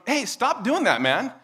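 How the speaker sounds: high, loud pretend objection; mock annoyed protest. Eric objecting to being smacked, mock annoyed. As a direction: act out a fed-up 'stop it'. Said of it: "And Eric tells me".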